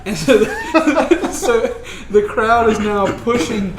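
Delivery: narrating through laughter; laughing. Real, not acted. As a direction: laugh while telling it.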